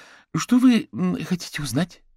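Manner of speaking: high, hesitant woman's question; cautious question. A narrator's reading. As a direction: ask carefully, pitched well up. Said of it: "осторожно спросила мама пацана". (осторожно, высоким голосом)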